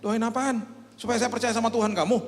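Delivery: high-pitched indignant retort; acted huffy challenge; offended. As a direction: snap back, affronted, pitch way up. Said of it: "Itu teman yang ateis tersinggung".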